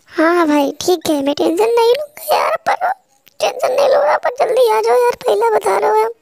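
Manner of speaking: very high, pleading, near-tears whine; high girlish voice whining at a friend to save her; whiny scared teammate. Acted. Teammate replies, still pleading.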